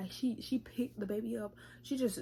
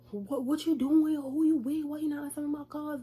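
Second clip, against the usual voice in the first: high, loud, accusing questions; nagging girlfriend on the phone, hammed up; mock angry demand; 7 semitones higher, 7 dB louder than usual. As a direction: fire off jealous questions at him. Baby mama calling, jealous demands.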